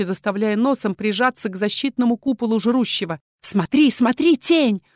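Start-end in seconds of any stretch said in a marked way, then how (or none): 3.4-4.8 excited hoarse rasp. A narrator's reading. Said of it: "возбуждённо хрипела Валерита".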